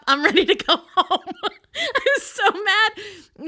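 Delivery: high, loud, laughing through the words; laughing exasperation. Real, not acted. Storyteller recalling being fed up at funeral, laughing loudly. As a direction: crack up while retelling your own anger.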